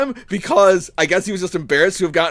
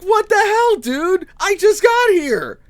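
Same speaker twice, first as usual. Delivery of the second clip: indignant yell; 13 semitones higher, slower than usual. Retold or imitated. Apprentice retelling his protest to Gregor, shrill and indignant. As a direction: squeal it out in disbelief. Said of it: "I'm just like".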